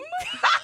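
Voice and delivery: high, loud burst of laughter; loud shrieking laugh. Real, not acted.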